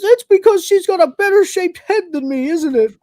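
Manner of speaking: high, loud, hurt whine; hammed-up jealous protest; jealous whining. Acting out Crichton's jealous whiny meltdown.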